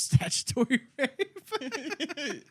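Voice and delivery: high laugh through the words. Real, not acted. Joking to friend, laughing.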